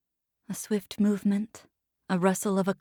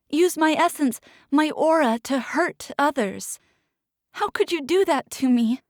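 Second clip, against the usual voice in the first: high, loud, desperate plea; anguished pleading; 6 semitones higher, 4 dB louder than usual. Acted. Captive pleading with Bay, high and loud. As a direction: make him feel your fear.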